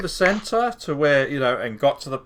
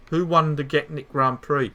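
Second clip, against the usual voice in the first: ordinary talk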